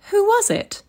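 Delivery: high, light wife voice; husband asks, wife answers in a high light voice; wife's voice. A narrator's reading. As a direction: play the wife teasing her husband.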